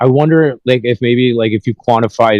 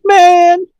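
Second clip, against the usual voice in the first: mock [name]; 5 dB louder than usual. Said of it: "He's like, he's like".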